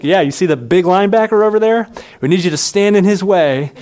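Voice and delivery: mildly insistent teammate laying out the play; quarterback giving orders. Acted. Acted quarterback instructing a rookie.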